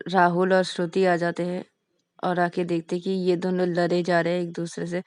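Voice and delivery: plain reading voice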